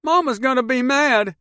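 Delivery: put-on high, loud frightened kid; scared child. A narrator's reading. Boy in the back seat, high frightened cry.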